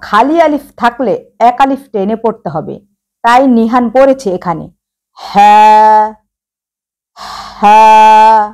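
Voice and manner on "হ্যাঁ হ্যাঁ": হ্যাঁ stretched long and loud, one alif length; drawn vowel. Shown as a demonstration. Teacher shows how Nihan drew out the vowel, long and loud. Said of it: "এভাবে নিহান".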